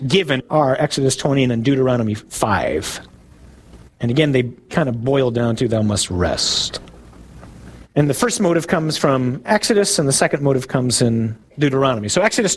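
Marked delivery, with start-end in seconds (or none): 5.7-6.8 low, slow decree; solemn commandment. Acted. Preacher boiling the commandments down for listeners, solemn commandment. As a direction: pronounce it gravely, dropping deep and slow.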